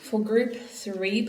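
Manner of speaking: usual speaking voice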